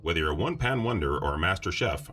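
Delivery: deep, smooth ad announcer; old radio announcer. Acted. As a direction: read it like a vintage broadcast. Mock station break ad read.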